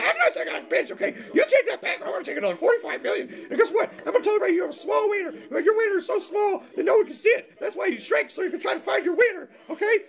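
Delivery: very high mock-furious falsetto; quarrelling ex-wife. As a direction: squawk insults as an indignant ex-wife. Ex-wife pops back, acted quarrel.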